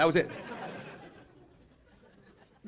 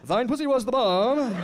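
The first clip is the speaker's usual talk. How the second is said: slow, hammed-up old-timey suitor, higher and louder; mock olde English lover; 8 semitones higher, 6 dB louder, much slower than usual. Ben bragging to his mistress in a letter, slow mock-archaic croon. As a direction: ham up a flowery colonial love letter.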